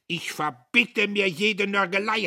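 loud, high, indignant; peremptory demand. A narrator's reading. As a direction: forbid it at full voice. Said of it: "heischte Diederich".

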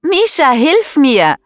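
very high, loud cry for help; calling for help. A narrator's reading. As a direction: shout for rescue, pitch way up. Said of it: "ruft Paula". (hoher, lauter Hilferuf)

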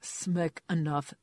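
slow, tired and fed up; weary. A narrator's reading. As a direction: drag it out wearily. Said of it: "said C G wearily".

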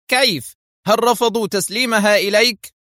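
high, loud incredulous outburst; shouted outrage. A narrator's reading. Foster jumping up from his desk, shouting. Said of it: "هب فوستر من خلف مكتبه صائحا".

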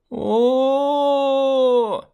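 high, loud crying howl; child's howl. A narrator's reading. Girl with a scratch howls, high and loud. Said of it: "выла Миранда".

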